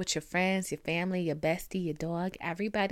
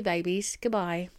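ordinary delivery

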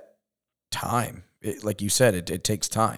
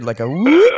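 put-on accent whoop; 7 semitones higher, 7 dB louder than usual. Imitating the Montauk accent sound.

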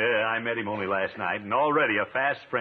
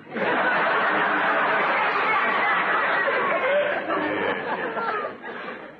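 high, drawn-out rolling chuckle; long rolling laugh; 4 dB louder than usual. Real, not acted. Host cracking up at Peavy's joke, long rolling laugh. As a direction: let the laugh roll on and climb high.

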